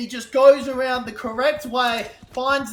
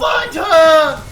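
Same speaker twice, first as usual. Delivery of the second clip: normal talking voice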